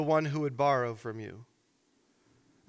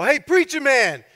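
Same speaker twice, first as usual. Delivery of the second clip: loud, higher-pitched taunt; mock heckling; 7 semitones higher, 6 dB louder than usual. Cocky thug berating a pastor in the pub, mock heckling.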